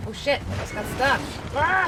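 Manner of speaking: high panicked cry while trapped. Real, not acted. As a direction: yelp in sudden panic. Caught by the monster.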